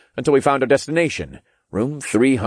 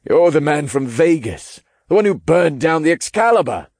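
startled man voice; 8 semitones higher, 4 dB louder than usual. Acted. Zeitman recognizing them.

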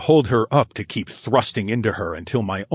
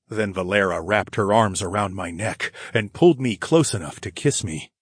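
ordinary delivery, nothing marked

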